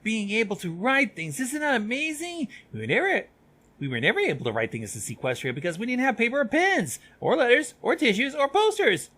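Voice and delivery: high-pitched excited rambling; Silverstream. Acted. Chatty student rambling to friends in the hallway, excited. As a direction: ramble on giddily, building in pitch and volume. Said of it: "listening to Silverstream ramble on and on".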